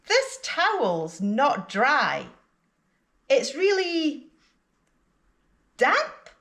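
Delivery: long pause, then "damp" high and questioning; unsure of "damp", raise it like a question; rising intonation on "damp". Shown as a demonstration. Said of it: "you can use rising intonation on the word that you're not sure about".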